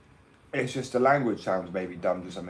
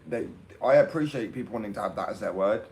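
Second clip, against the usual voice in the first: normal talking voice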